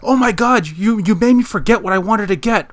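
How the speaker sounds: retold panicked outburst, high and loud; loud, high-pitched mock freak-out; screaming on phone. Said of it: "he starts screaming on the phone call".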